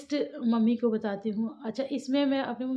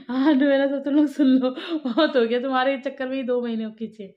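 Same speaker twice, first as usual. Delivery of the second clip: loud, half-joking "enough already" to the viewers; a playful scolding, louder; mock telling-off; 6 dB louder than usual. Vlogger jokingly scolds viewers about baby names, loud mock telling-off.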